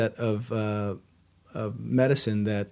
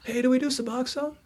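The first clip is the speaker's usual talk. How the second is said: high, raised call to the back; calling out across office; 12 semitones higher than usual. Retold or imitated. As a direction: call out to a coworker. Receptionist calls to coworker.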